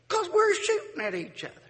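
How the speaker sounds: high, matter-of-fact feuder; feuder's reply. Retold or imitated. Feuding neighbor answering the stranger, high and obvious.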